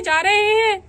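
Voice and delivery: ordinary talk, nothing marked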